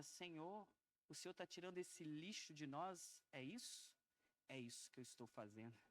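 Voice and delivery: softly retold dialogue with God; hushed reverent prayer. Preacher retells asking the Lord and hearing the answer, hushed. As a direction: drop to a soft, reverent voice.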